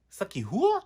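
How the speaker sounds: high, loud incredulous comeback; high, loud mock disbelief; shocked retort. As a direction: fire it back, stunned and loud. Retort to drunk man's offer.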